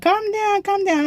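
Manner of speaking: high-pitched mock lady cop; high imitation of an officer calming someone; imitated lady cop. Imitates lady cop to crying speaker.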